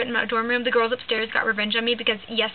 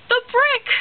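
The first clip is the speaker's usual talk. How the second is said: much higher, louder triumphant cry; spring the punchline with glee; punchline reveal; 12 semitones higher, 6 dB louder than usual. Retold or imitated. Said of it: "that's my impersonation".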